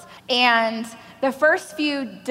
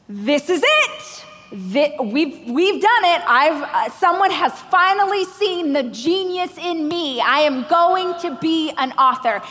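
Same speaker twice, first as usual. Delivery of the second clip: giddy excitement; 4 semitones higher, slower than usual. Retold or imitated. Imitating her own dream come true.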